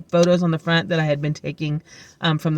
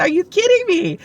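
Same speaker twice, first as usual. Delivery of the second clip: high-pitched retold amazement; excited disbelief; 11 semitones higher than usual. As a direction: exclaim it in delighted disbelief. Retold amazed reply to coworker.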